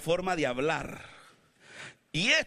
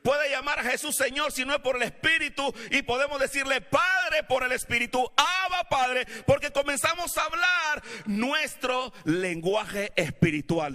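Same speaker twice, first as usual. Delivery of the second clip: preaching at full force; 7 semitones higher than usual. Acted. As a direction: drive the message home to the congregation.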